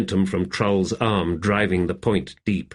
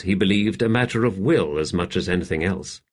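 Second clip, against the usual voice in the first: usual speaking voice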